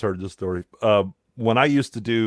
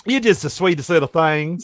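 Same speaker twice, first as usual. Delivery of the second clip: mock Southern belle; 5 semitones higher, 3 dB louder than usual. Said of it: "You just have the cutest accent".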